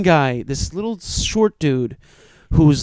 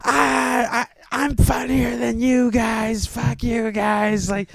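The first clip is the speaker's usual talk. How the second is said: retold screaming rant; 8 semitones higher, slower than usual. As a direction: yell it like a heckler gone off the rails at the crowd. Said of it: "just screaming at the top of his lungs".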